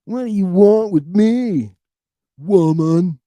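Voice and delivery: gripey Jesus. Retold or imitated. Jesus snapping at Mary, pissed off and gripey. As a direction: play Jesus annoyed at his mother.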